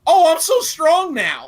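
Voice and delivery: loud, high mock boasting; hammed-up triumphant brag, drawn out; imitating Etika. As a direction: crow it slowly like a proud strongman. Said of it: "He's like".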